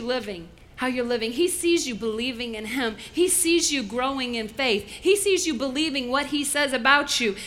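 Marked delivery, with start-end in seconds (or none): none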